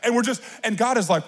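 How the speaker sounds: ordinary talk, nothing marked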